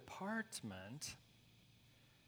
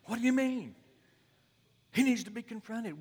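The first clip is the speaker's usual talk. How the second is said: much higher and louder, protesting; indignant young pastor; 11 semitones higher, 13 dB louder, faster than usual. Retold or imitated. Young pastor pushing back at his mentor, high and loud. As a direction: object like he can't believe it. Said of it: "young pastor protesting".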